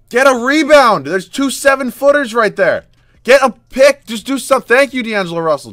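loud, high yells at the screen; frustrated yelling. Real, not acted. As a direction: bark orders at your own team. Yelling at players during game.

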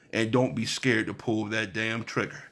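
normal talking voice

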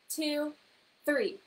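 ordinary talk

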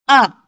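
short "A" falling sharply from high; fourth tone. Shown as a demonstration. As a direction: drop "A" quickly from the top. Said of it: "imitate the tones".

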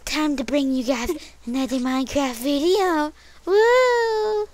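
high creepy put-on voice; a creepy falsetto greeting. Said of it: "I was doing a creepy voice".

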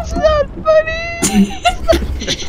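high, shrieking through laughter; laughing shout. Real, not acted. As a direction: shout it while cracking up. Laughing fit, try not to laugh.

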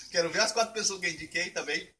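usual speaking voice

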